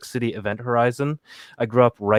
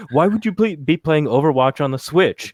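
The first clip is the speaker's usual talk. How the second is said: higher, louder mock objection; Devindra; 4 semitones higher, 5 dB louder than usual. Player hears colleague in his head, imitated in mock objection. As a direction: question it loudly, with pitch raised. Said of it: "I just heard Devindra in my head".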